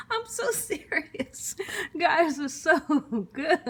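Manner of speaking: high, laughing through the words. Real, not acted. Cook delighted by the dish, laughing through it.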